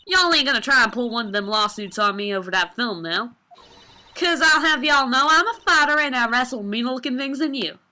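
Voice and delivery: a tough Southern woman squaring up; Southern accent. Acted. Southern photographer warning off a lawsuit, blustering Southern accent. Lotta threatens.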